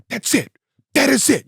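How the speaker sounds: high, excited imitation of an animated wrestling manager; imitating Paul Heyman getting excited like a kid. To the host, imitating Paul Heyman's excited reaction to the promo. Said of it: "Pretty good Paul Heyman".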